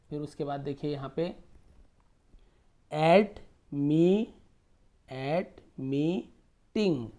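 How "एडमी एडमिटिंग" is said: Admitting drawn out syllable by syllable; very slow sounding out. Shown as a demonstration. Teacher sounding out admitting for the class, very slowly. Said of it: "teacher sounding out word".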